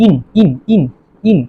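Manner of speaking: ইন said as a separate ending, "een"; pronunciation demo. Shown as a demonstration. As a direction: repeat ইন distinctly, with pauses between. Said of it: "উচ্চারণ কি হবে".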